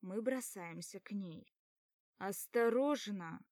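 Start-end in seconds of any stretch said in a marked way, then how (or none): 2.2-3.4 louder, slow cry of warning; three friends yelling a warning. A narrator's reading. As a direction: yell to stop her. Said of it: "дружный вопль трёх глоток".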